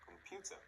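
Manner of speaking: com-PU-ter, stressed in the middle; middle-syllable stress. Shown as a demonstration. Teacher modelling a word to repeat, middle syllable stressed in Computer. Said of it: "It can be in the middle".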